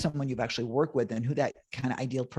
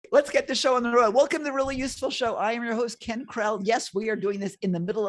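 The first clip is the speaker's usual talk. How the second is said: show host intro; 8 semitones higher than usual. Acted. Host opening his online show, in a hyped announcer voice. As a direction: kick off the show like a game show host.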